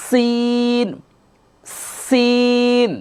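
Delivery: সিন with a long breathy s and high pitch; airy sin. Shown as a demonstration. Teacher showing the air on সিন for students. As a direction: let the air hiss out on সিন. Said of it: "একটা বাতাস বের হবে".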